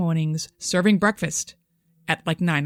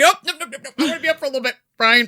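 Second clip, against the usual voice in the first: put-on drunk protest; 4 semitones higher, 6 dB louder, faster than usual.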